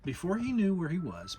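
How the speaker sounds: usual speaking voice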